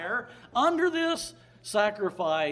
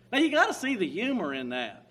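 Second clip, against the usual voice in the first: ordinary talk, nothing marked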